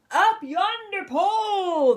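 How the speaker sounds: crew shouting. A narrator's reading. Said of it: "the others shouted".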